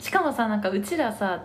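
usual speaking voice

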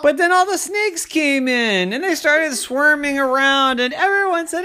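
high, loud, breakneck-drama storytelling; alarmed narration. A narrator's reading. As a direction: narrate the invasion in a panic. Narrator at snakes swarming in.

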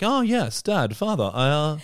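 high prim posh English "Oh, yes, Dad, Father". Retold or imitated. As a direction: put on a stuffy upper-class English voice. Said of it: "everyone in the family was like".

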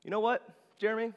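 lightly voiced young woman, quick and high; a hint of the girl leaning in with news; girl's voice. College girl confiding to Jeremy, lightly mimicked high voice. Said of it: "she was like".

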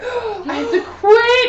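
gasp, then a slow laughing shout; hammed-up panic, half laughing; mock dramatic outburst. Joking she's allergic to work.

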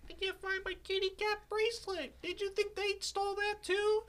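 put-on high, sweet little girl voice. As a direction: play the worried little daughter. Voicing the little daughter.

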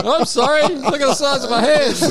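high-pitched, panicked mock apology. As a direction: play the hapless man, voice pitched high. Acting out the huge-handed man.

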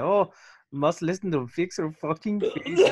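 mock accent of an imagined German listener; vague foreign accent. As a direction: put on a silly foreign accent for German fans. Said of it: "I don't even know what that accent was".

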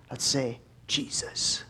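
breathy whispered call to say the name. Shown as a demonstration. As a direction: whisper it like a secret. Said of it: "Sometimes I'm sneaky".

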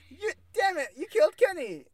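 high, loud cartoon outrage; hammed-up squawk of protest; mock-outraged catchphrase. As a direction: cry it out like a cartoon catchphrase, half laughing. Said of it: "that's the catchphrase".

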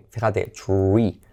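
tree said slowly, tr with a u-like r; tree as choo-ree, r close to u; English tree. Shown as a demonstration. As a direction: say tree with the r almost like a u. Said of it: "la R suena casi como una U después".

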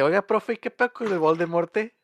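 pregunta alto y directo al profe; Harry directo al grano. Retold or imitated. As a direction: encara al maestro sin rodeos, más fuerte. (Harry va directo al grano con el profe, voz alta)

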